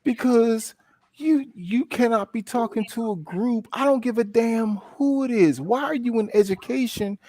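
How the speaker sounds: high-pitched acted confrontation; worked-up, high-voiced scolding; indignant telling-off. Rehearsed telling-off of camp co-director.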